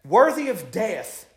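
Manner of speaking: high, rising preacher's call; fervent preaching. Acted. Preacher stirring the congregation, high and fervent. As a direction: press the point home to the congregation. Preaching builds to full force.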